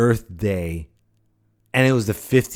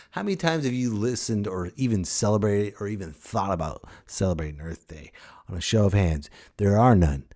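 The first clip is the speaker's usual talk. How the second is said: usual speaking voice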